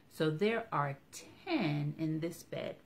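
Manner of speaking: ordinary delivery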